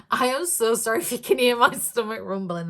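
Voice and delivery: normal talking voice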